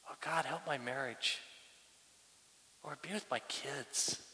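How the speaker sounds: hushed, very soft pleading; heartfelt prayer. Shown as a demonstration. Pastor showing prayer from the heart to God, hushed and heartfelt. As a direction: pray it quietly, with long pauses between pleas. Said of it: "pray when things are on your heart".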